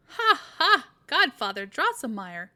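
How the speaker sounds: put-on boy laughing at his godfather's antics; loud laughing call. A narrator's reading. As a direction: burst in with a loud ha ha. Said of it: "interrupted him with loud laughter".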